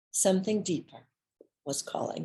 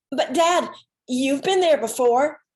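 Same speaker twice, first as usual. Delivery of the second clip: higher and louder, begging; pleading daughter; 7 semitones higher, 6 dB louder than usual. Retold or imitated. Daughter pleading with her worried dad, higher and louder.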